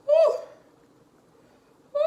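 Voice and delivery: high, loud "woo!" yelled out from the spice; burning-mouth yell. Real, not acted. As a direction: let out a loud pained whoop as the heat hits. Spicy food burning, yelling out.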